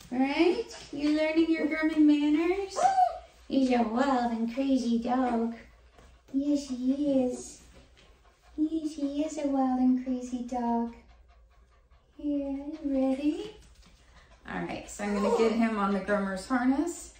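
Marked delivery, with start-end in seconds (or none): 0.1-0.7 pet talk. Real, not acted. Repeats question to dog, higher. 0.9-13.6 cooing pet talk. Real, not acted. Cooing baby talk at poodle.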